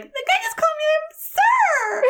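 very high, squealing mock outrage; highest pitched voice. Telling Jen about the guard, very high squeal. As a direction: squeal it out in disbelief. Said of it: "my highest pitched voice".

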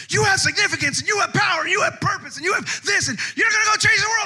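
high, fast shouted hype; mock revival crescendo; rousing preacher. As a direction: whip the crowd into a frenzy. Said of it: "riles you up and goes".

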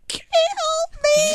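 very high, strained, drawn-out plea; dying monster plea. Retold or imitated. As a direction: beg like a broken creature. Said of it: "she's like".

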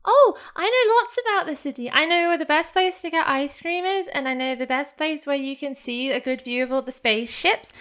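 put-on a boy showing off his city; eager kid.

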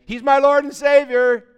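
loud, high declaration; booming out a retort, pitched high; loud proclamation. Acted. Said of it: "every time they shout that out".

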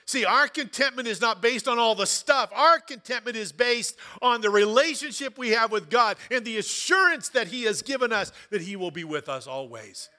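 loud, high, almost singing; fervent preaching. Acted. Pastor driving home contentment to the church, loud and lifted. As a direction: lift the congregation with it.

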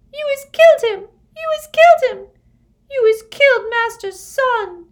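frantic, very high house-elf wail; piercing shriek. A narrator's reading. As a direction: scream the accusation in a tiny squealing voice. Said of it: "she let out a piercing shriek".

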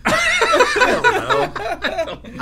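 drawn-out, high laughing words. Real, not acted. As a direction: laugh it out slowly. Laughing after gross food.

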